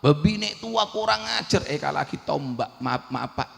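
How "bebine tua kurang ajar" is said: angry insult. Acted. Master continues cursing.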